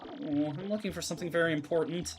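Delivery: lightly voiced, timid and worried searcher; a hint of anxious, soft character voice; Vivian. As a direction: fret softly while searching.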